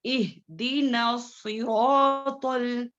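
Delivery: very slow इहदीना सिरातुल, ta held thick; heavy ta. Shown as a demonstration. Teacher reciting slowly for pupils to copy. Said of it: "इसको बारीक नहीं पढ़ना है".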